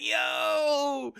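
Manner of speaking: long, high, drawn-out exclamation; retold amazed reaction. Fan reacts to Goku's admission, drawn-out amazement. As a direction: stretch it out in mock awe. Retells hyped reaction to scene.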